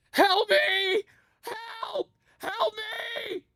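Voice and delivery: high desperate screams for help; screaming for help. Shown as a demonstration. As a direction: scream help at the top of your voice. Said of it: "make our own Half-Life zombie sound".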